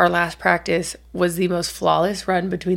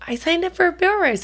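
put-on much higher, quick kid voice; imitating daughter; 10 semitones higher, faster than usual. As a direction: play the eager daughter, high and fast. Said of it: "she said".

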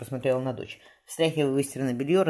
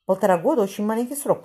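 mild grumble, higher and louder; grumbling; 9 semitones higher, 6 dB louder than usual. A narrator's reading. Old man objects to the short term of study, mildly grumbling. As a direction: grumble it lightly, pitched up. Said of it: "проворчал кто-то из стариков".

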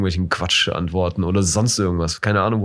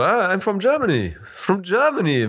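cheerful, higher and beaming; proud and upbeat, much higher; happy tone; 9 semitones higher than usual. Shown as a demonstration. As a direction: sell it with a big happy lift. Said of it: "mit so einer Happy-Tonalität".

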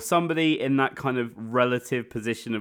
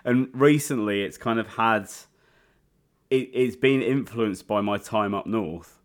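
usual speaking voice

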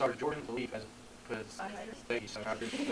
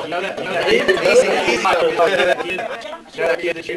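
slow, huge, much louder and higher demonic taunt; 5 semitones higher, 17 dB louder than usual. Acted. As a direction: loom over him like a gloating demon. Demon speaks through possessed man.

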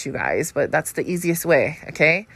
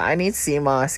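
ordinary delivery

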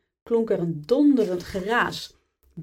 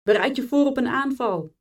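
raised, high soldier's call; urgent, higher-pitched battle warning; shouted order; 6 semitones higher, 4 dB louder than usual. A narrator's reading. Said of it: "schreeuwde een ander".

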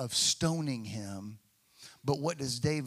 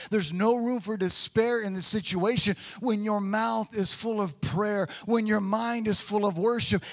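louder, higher sermon climax; full-force preaching; 6 semitones higher, 5 dB louder than usual. Acted. Pastor rallying the congregation at the close, preaching loudly. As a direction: lift it into a rousing close.

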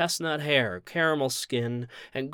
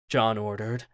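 ordinary delivery

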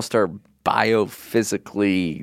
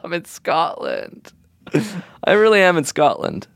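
mock crying; 8 semitones higher than usual. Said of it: "You're crying".

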